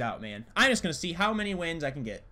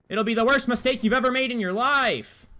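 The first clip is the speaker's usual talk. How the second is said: high, raised-voice mock menace; weird dramatic threat; 8 semitones higher, 4 dB louder than usual. Gamer warning an enemy not to peek, hammed-up threat. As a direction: overplay the warning, pitched well up. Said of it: "I don't know why I said it like that".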